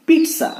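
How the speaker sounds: "pizza" as PEET-sa, high-pitched; TS pronunciation. Shown as a demonstration. Teacher giving the correct form, TS pronunciation. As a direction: say "pizza" with a crisp T-S.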